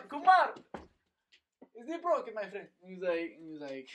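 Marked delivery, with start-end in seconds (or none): 0.1-0.5 loud, high mock Saudi call; hammed-up high shout to a friend. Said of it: "he goes over to". 1.7-2.7 high-pitched mock Saudi accent; put-on Saudi accent, pitched up. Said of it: "one of the Saudi guys".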